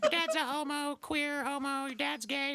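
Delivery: put-on high, slow taunting kid voice; mock a little kid taunting in chat; squeaker voice. Kid taunting players in a game lobby, high.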